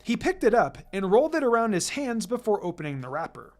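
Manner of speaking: ordinary delivery, nothing marked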